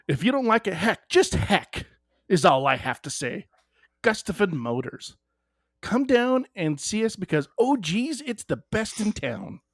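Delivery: folksy UP sales pitch with lilting emphasis; Upper Peninsula accent. Acted. Salesman retaking his fumbled sponsor spot, UP accent. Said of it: "if you're going to use the U P accent".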